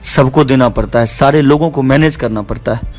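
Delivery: usual speaking voice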